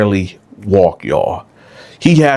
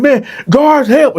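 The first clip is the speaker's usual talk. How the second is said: high desperate cry; calling for help. Retold or imitated. Dylan still crying for the guards, high desperate call. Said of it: "You're like".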